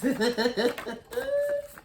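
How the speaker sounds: sudden high cackle of amusement; high burst of laughter. Real, not acted. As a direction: let out a big high laugh. Laughs after own remark.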